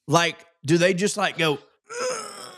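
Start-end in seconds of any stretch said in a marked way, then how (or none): 1.5-2.5 a very high, strained tree groan; mock strained groan. Voicing a tree deciding to grow spikes, with a mock groan. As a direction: squeeze out a tiny effortful groan.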